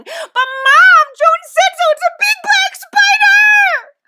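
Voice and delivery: put-on shrieked, very high child's protest; squealing. A narrator's reading. Anne grabbing her mother's arm, squealing about a spider. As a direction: squeal it at full volume in panic.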